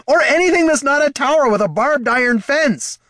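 loud, very high panicked holler. Acted. Heraldon panicking at the tower, hollering. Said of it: "hollered Heraldon".

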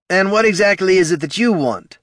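high, loud suspicious voice; a guard behind a door slot; muffled guard voice. Acted. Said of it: "said a muffled voice".

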